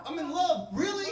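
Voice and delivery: very high, pleading voice; high incredulous pleading; incredulous whine. Retold or imitated. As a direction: whine in disbelief at the price. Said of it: "I'm like".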